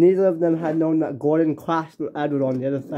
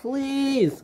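pleading; 10 semitones higher, 3 dB quieter than usual. Acted. Edward pleads before the crash.

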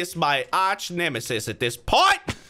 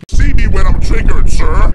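booming monster bellow, much louder; huge mock Hulk roar; 18 dB louder than usual. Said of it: "what the Hulk said".

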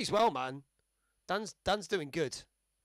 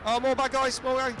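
panicking gamer; 5 semitones higher, 5 dB louder than usual. Real, not acted. Enemies appear, panicked.

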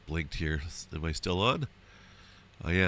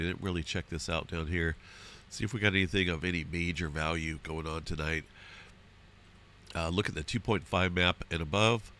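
usual speaking voice

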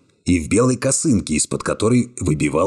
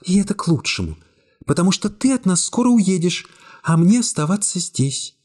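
put-on lightly raised young woman voice, cool and calm; young woman's voice; 13 semitones higher than usual. A narrator's reading. Secretary coolly refusing the hero's embrace, high young woman voice. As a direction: voice her higher, calmly distant.

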